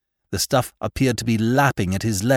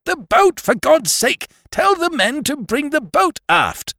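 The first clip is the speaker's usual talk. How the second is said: shouted. A narrator's reading. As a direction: yell it straight into his face. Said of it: "I shouted at him".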